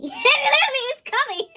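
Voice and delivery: high, loud laughter through the words; laughing. Real, not acted. Reader cracking up at the Empress line, loud laughing.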